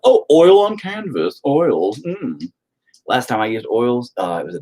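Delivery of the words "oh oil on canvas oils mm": higher, slow, hammed-up posh art snob; mock the fancy painters; mock snooty artist. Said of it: "And they're like".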